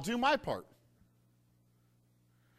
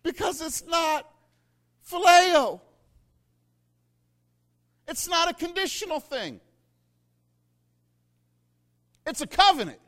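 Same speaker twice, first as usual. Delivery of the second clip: higher and louder sermon climax; preaching at full force; 11 semitones higher, 8 dB louder than usual. Acted. Pastor preaching to the congregation, full force and rising. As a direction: drive the point home to the church.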